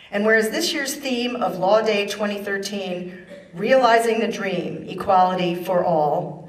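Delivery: measured, declamatory official reading; formal proclamation. Acted. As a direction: read it like a governor's decree, weighty and public. Reads governor's proclamation aloud.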